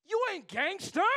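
high, loud, playful disbelief; mock shocked shout. Young man shocked at the pastor, mock shouting. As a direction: blurt it like you can't believe it. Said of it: "it's like".

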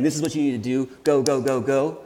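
mock-bark it like a drill sergeant pushing a recruit; a hint of a drill sergeant rushing Moses out the door. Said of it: "He's not a drill sergeant saying".

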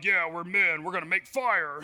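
low mock-manly boast; macho men. Dads making fire at a party, low mock-macho voice. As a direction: lightly put on a caveman bravado. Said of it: "and we're like".

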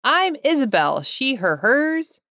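big, animated welcome read; podcast intro. Acted. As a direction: sell the show's opening with punch. Reads show intro.

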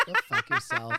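high, loud, laughing jab; laughing outburst. Acted. Mocking every president, high and laughing. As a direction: fling it out mid-laugh.

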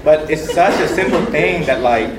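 ordinary talk, nothing marked

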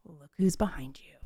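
soft, unvoiced whisper; imitated whisper. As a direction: warn your partner without being overheard. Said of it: "I was like".

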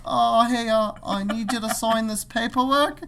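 high-pitched imitated Aussie trucker; Australian accent. Trucker needing paperwork signed, mock Australian accent. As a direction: mimic an Australian, pitched well up. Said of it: "making fun of his accent".

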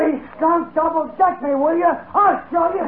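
angry accusation. Acted. Angry gambler accuses cheat.

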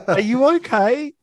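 high, laughing "Are you okay?"; laughing mock concern. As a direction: ask it through giggles.

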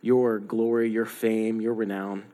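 soft, low, hushed prayer; closing prayer. Real, not acted. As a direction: pray it quietly to close.

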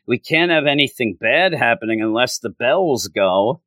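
ordinary delivery

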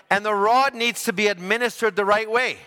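ordinary talk, nothing marked

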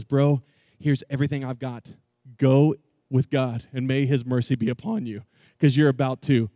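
a low, lightly voiced king Saul; deep kingly voice. King Saul hands David his armor, in a low lightly kingly voice. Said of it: "he says".

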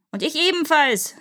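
high, restrained cry; mock demon yell. A narrator's reading. Third demon joins the quarrel, mock yelling. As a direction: pipe up in a mild mock yell. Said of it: "schrie der dritte".

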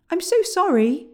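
calling out loudly, apologetic. A narrator's reading. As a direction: call the apology up high. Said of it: "called William".

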